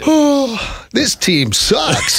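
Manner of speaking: sighing, slow, fed-up call; biased announcer. Pretend. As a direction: groan about the team on air. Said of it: "hiding my bias would be hard".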